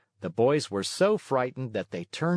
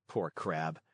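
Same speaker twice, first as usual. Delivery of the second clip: usual speaking voice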